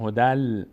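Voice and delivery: هدل with the final nun merged into a held L; nun read as lam. Shown as a demonstration. Teacher showing tanwin before lam, nun pronounced as L. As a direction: say هدل ending on a long lam.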